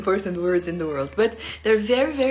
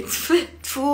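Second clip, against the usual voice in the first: disgusted spitting; 6 semitones higher than usual. Shown as a demonstration. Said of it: "if you're disgusted".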